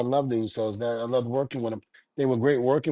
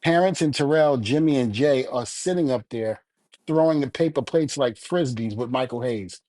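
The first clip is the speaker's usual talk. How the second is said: usual speaking voice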